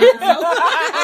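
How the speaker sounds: big high laugh breaking out; sudden loud laugh at her friend; loud burst of laughter. Real, not acted. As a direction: crack up laughing. Friends joking about kneeling.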